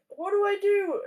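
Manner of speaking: high, panicky plea; panicking friend. Retold or imitated. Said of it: "he's panicking".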